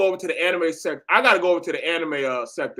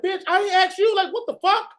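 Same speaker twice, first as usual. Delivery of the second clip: high, indignant mock girl voice; girl's comeback, pitched up and offended; sassy girl's comeback; 11 semitones higher than usual. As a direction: snap back like an annoyed girl. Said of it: "the girl be like".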